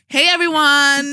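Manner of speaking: loud, slow podcast host welcome; host greeting. Acted.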